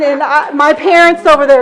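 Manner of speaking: usual speaking voice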